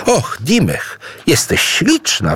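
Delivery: high-pitched admiring exclamation; exclaiming. A narrator's reading. Idu sees Dimech as a girl, exclaiming in admiration. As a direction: exclaim in delight, pitch leaping. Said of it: "wykrzyknął Idu".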